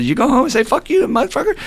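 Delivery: high-pitched mock cursing; mirroring a rant. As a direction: imitate the old timer acting out a rant. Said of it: "mirrored it for me".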